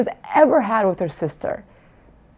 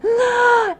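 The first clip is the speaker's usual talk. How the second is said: delighted high gasp. Retold or imitated. Mother imitates daughter's delighted gasp on seeing her. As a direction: gasp with joy like a little girl. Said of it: "she goes".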